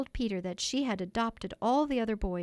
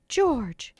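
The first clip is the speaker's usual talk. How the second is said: louder, higher pained cry; pained exclamation; 5 semitones higher, 6 dB louder than usual. A narrator's reading. Wife exclaims, pained at her husband's behaviour. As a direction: call his name, hurt by him. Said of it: "Missus Darling exclaimed, pained".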